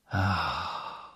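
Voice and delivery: low, soft "ah" of relief; contented sigh. A narrator's reading. Tired player lying in a hot bath, sighing contentedly. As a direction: let out a soft sigh of pleasure.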